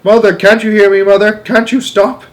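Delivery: high, loud, desperate pleading; wailing plea. A narrator's reading. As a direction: beg her to stop. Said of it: "wailed Missus Bucket".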